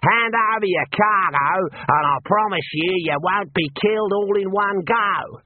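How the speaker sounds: the Emu rider taunting the wizard; bandit's taunt. Acted. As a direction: threaten him with cheerful menace.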